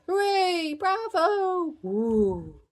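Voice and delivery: loud, high audience cheer; whooping applause cries. A narrator's reading. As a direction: cheer like delighted parents at a show. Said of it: "The parents clap and cheer".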